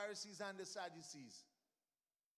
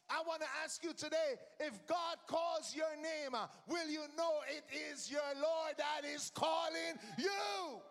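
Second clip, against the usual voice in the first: louder, higher, driving the question home; preaching shout; 3 semitones higher, 4 dB louder than usual. Real, not acted. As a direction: call out to the whole church. Preaching climax to congregation.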